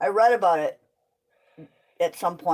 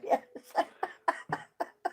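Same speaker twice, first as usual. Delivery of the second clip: laughing; 12 semitones higher than usual. Real, not acted. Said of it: "That was a good one".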